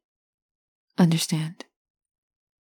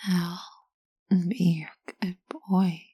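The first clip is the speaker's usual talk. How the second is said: slow whisper; 4 dB quieter, slower than usual. Shown as a demonstration. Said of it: "you're going to repeat to yourself".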